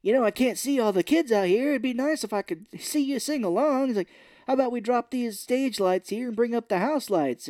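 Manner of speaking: lightly put-on wholesome voice; kids' performer. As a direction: address the crowd like a children's host. Acting out performer addressing audience.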